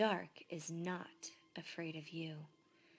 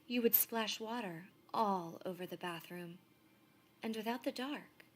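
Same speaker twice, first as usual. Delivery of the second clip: ordinary delivery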